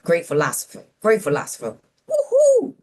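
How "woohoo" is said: high loud whooping call; woohoo call. Retold or imitated. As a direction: whoop it like a rally cry. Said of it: "That was his call to arms".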